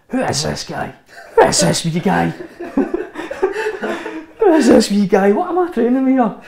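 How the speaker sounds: mock the new kid while laughing; laughing mock taunt. Teammate Lenny mocking the wee new guy, loud laughing jeer. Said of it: "Lenny was actually this".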